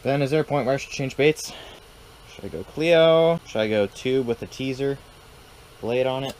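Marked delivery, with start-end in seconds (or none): none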